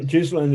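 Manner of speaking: Ghislaine said as "JIZ-lane"; wrong pronunciation. Shown as a demonstration. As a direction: repeat Ghislaine with a J start and sounded S. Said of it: "Ghislaine is wrong".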